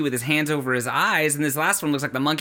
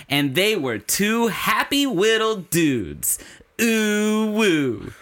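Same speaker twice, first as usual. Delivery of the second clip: put-on hammy kids show host; 6 semitones higher, much slower than usual. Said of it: "I get to this one line where I'm just like".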